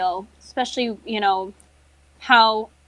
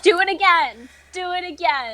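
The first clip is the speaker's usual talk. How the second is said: higher-pitched mock dare; daring her, pitched up in hammed-up outrage; retold challenge; 8 semitones higher, 4 dB louder than usual. Co-host acting out her comeback to the rude woman, mock challenge. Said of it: "and I'm like".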